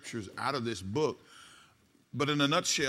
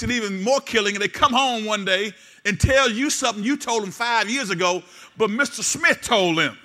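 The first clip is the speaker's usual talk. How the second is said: loud, higher-pitched sermon delivery; fervent preaching; 8 semitones higher, 11 dB louder than usual. Real, not acted. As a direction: preach it forcefully to the congregation. Preaches at full force.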